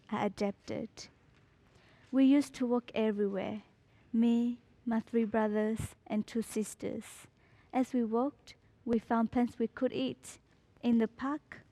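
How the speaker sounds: ordinary delivery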